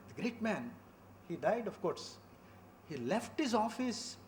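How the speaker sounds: normal talking voice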